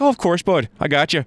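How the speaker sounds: Newfie imitation. Newfoundlander bank agent obliging a caller, lightly imitated Newfie. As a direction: agree breezily as an easygoing Newfie. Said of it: "Newfie would be like".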